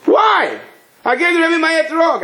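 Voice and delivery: high-pitched, indignant wail; acted outraged protest. Visitor protests being shut out.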